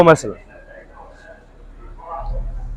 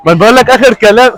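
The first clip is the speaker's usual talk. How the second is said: raised, higher excited voice; excited insisting; 5 semitones higher than usual. Real, not acted. Friends protest, he insists loudly.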